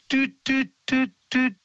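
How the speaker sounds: high, separate piping notes; evenly spaced high toots; blackbird's extra verse. Retold or imitated. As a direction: imitate a bird's repeated short whistle. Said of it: "hängt hinten noch so eine Extrastrophe ran".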